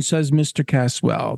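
ordinary delivery, nothing marked

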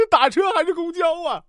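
high, anxious put-on questioning; indecisive patient. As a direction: press the doctor with a high, needy question. Indecisive patient voiced against doctor.

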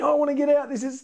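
hammed-up fear of kids stuck on the ride; mock panicked kids. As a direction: squeal it like a frightened little brother. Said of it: "they're trying to".

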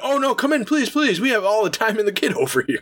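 a prince abruptly welcoming the hero he just turned away; sudden eager welcome. Retold or imitated. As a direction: flip from cold to fawning host. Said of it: "that's how fast it changes".

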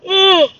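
a loud, high, open-mouthed yawn; big yawn. Real, not acted. Stifling a yawn mid-chat with viewers, loud and high. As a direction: let a real yawn take over. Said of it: "Oh, excuse me for the yawn".

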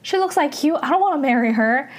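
teasing refusal, hammed-up and fast; mock indignant protest. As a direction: whine it like a bickering friend. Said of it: "Park Seo-joon gets mad".

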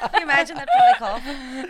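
loud, high, slow laughing words. Real, not acted. Friend picturing a cough in the audition, laughing hard. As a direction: barely get it out through laughter.

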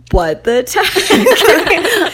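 high, slow, laughing what the heck; shocked laugh. Retold or imitated. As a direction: blurt it out in disbelief, laughing. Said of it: "And I was like".

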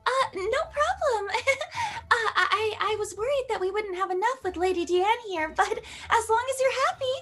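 anime princess voice. Acted. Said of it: "Princess Elizabeth".

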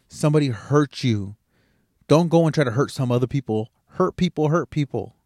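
normal talking voice

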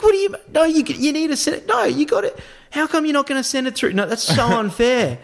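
very high, hammed-up phone protest; mock argument. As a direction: pretend to argue, pitch shooting up. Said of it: "start having an argument on the phone".

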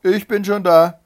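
high, loud triumphant call. A narrator's reading. Wife greeting the arriving hare, loud call. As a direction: call it right out across the field. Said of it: "rief ihm".

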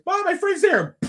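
put-on high, loud kid voice; imitated excited kid. Kid running off to a friend, high excited call. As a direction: pipe up like a child spotting a friend. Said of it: "my kid disappears".

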